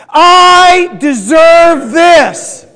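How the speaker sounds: very slow, loud, high shout; drawn-out emphatic roar; like you mean it. Shown as a demonstration. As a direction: bellow it with full conviction, stretching each word. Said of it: "like you mean it".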